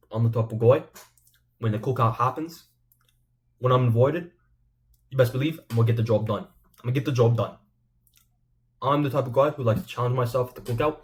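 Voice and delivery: shaky-footed attempt at an Australian accent; Volk. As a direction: put on a bad Australian accent, earnest boasting. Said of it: "I can't do an Australian accent".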